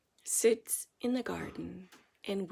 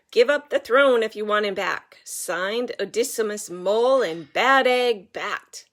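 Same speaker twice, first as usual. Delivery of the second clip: menacing ransom note; 10 dB louder than usual. A narrator's reading. Villains' ransom note read aloud.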